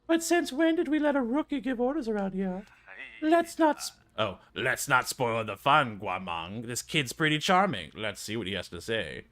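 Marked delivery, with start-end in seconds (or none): 0.1-6.7 high, sly elder voice. Acted. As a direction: needle the rookie with a mocking lilt.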